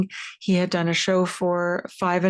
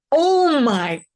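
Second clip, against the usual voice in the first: slow, high, drawn-out amazement; slow gasping oh my God; stunned; 10 semitones higher, 3 dB louder, much slower than usual. Retold or imitated. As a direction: let it dawn on you in awe. Stunned realization at lunch table.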